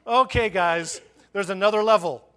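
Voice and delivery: loud, rallying sermon delivery; forceful preaching. Real, not acted. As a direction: drive each item home to the congregation.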